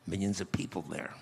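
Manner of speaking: ordinary talk, nothing marked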